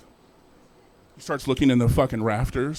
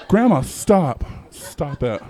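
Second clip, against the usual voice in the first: playful stop; 2 semitones higher, 5 dB louder, much slower than usual. Retold or imitated. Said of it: "playful protest".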